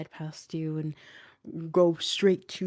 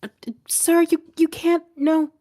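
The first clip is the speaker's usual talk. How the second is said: much higher, loud flustered protest; feminine voice; 13 semitones higher, 11 dB louder than usual. Acted. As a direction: put on a high woman's voice, calling out in protest. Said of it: "a feminine voice go".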